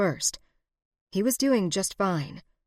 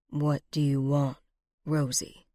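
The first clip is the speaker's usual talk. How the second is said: low, controlled menace; deep and controlled; 3 semitones lower than usual. A narrator's reading. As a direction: hold the anger down tight. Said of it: "His voice was deep and controlled".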